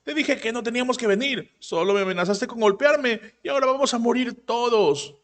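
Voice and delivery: high, panicky scolding in a small voice; the little shaman whining at the top of her pitch; mock yell. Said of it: "está gritando".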